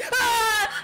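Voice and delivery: sudden scream. Real, not acted. Jump scare in horror game.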